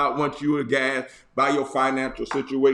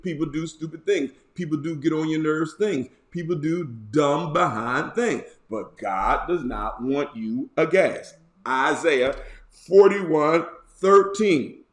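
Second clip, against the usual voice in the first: ordinary delivery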